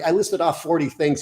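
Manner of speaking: ordinary talk, nothing marked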